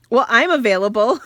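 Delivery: comic retold interjection, punched out loud; piping up. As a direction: pipe up loudly, playfully offering yourself. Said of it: "piped up and he goes".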